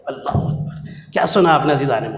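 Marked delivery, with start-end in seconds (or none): 0.1-1.1 a hushed, stunned whisper of awe; slow whispered Allahu Akbar; awed whisper. Real, not acted. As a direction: breathe it out, unvoiced and slow. Awed exclamation after the story.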